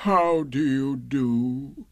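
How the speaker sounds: slow, gloomy donkey greeting; Eeyore, gloomy. A narrator's reading. Old donkey greeting his visitor, slow and gloomy. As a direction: greet gloomily, dragging every word. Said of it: "in a gloomy manner to him".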